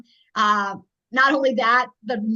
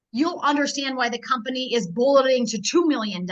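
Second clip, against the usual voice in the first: ordinary talk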